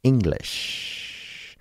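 breathy put-on English word; whispered posh Brit attempt; mock British accent. Comedian showing off his practiced English accent. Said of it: "I've been practicing my".

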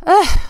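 heavy ugh, bracing to speak; exasperated groan. Real, not acted. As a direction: groan before gathering breath for a big answer. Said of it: "I need to catch my breath".

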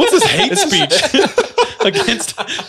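high, loud, laughing imitation of shock; mock outraged disbelief. Younger kids at dance hear the lyrics, mock shocked. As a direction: squeal it in scandalized disbelief through laughter.

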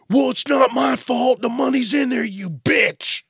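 high, loud indignant yell; hammed-up shout at the cashier; mock angry customer. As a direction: play the outraged customer.

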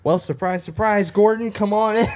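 high, loud game-show style reveal; high-pitched pretend welcome for a guest; mock surprise welcome. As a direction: belt out a playful surprise introduction. Playful act of greeting a guest Gordon.

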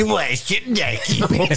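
high, slow whiny Cartman; high slow Cartman whine. Retold or imitated. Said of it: "All right, Eric Cartman".

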